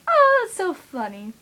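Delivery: high, slow, sing-song imitation of a younger girl; mock girly squeal. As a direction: ham up the little sister's gushing, high and loud. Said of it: "She's all like".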